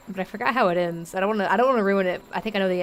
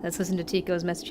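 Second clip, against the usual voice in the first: normal talking voice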